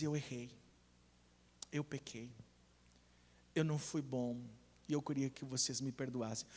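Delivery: low, hushed admission of fault; quiet confession. Acted. Father gathers his family to ask forgiveness, quiet confession. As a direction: confess softly, pausing between admissions.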